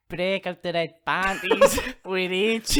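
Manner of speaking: slow, drawn-out Irish-Scottish brogue; Scottish accent. Retold or imitated. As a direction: do the Conor McGregor voice. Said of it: "he says it exactly like this".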